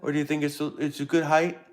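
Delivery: high mock wife question; imitating wife. Husband mimics wife fussing over mirror height, high voice. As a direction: keep up the high wife impression. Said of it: "And she's like".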